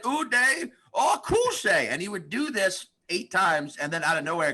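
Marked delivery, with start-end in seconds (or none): 0.0-1.9 higher and very slow Uday Akuse; slower comic impression. Comic imitating a man repeating his line even slower. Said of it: "I said".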